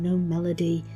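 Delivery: ordinary delivery, nothing marked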